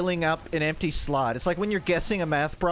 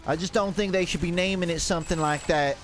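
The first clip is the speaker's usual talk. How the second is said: put-on Southern accent. Streamer spinning a strip mall yarn in a Southern accent. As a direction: talk like some kind of Southerner. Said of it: "what kind of Southerner talks like this".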